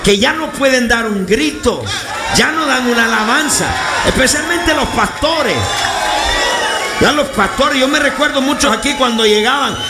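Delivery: high, shouted exhortation to the congregation; preaching at full force. Real, not acted. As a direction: preach it urgently, voice pushed high. Predica a toda voz a la congregación. (predicación a gritos, exhortando con fuerza)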